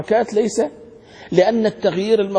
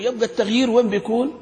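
ordinary talk, nothing marked

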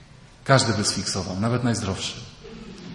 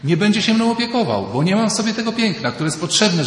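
a lightly voiced insecure Eve, higher and louder; pleading, higher Eve voice with a hint of fear; Eve's anxious thoughts; 5 semitones higher, 3 dB louder than usual. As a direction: voice Eve's fretful inner worry, pitched up. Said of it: "Ewa myśli tak".

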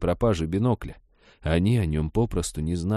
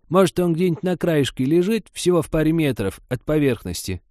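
put-on high, insistent old woman; forceful granny coaxing, pitched up; pushy; 9 semitones higher, 4 dB louder than usual. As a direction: press him eagerly to agree. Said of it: "напористо продолжала Степановна".

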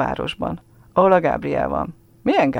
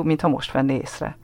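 ordinary delivery

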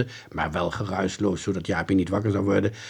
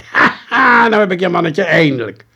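triumphant; 8 semitones higher, 10 dB louder than usual. Acted. Boy catches the intruder.

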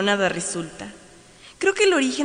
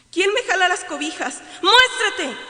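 terrified shout; 11 semitones higher, 5 dB louder than usual. Acted. Terrified at covers pulled.

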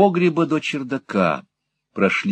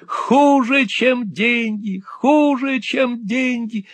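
much higher, slow despairing cry; 14 semitones higher, much slower than usual. A narrator's reading. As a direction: howl it like a man who lost everything. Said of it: "кричал старик".

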